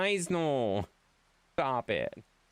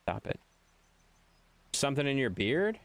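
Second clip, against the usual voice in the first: usual speaking voice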